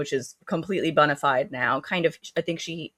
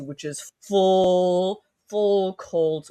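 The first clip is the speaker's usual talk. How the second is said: ordinary talk, nothing marked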